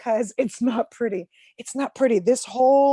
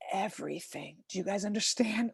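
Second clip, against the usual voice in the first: ordinary delivery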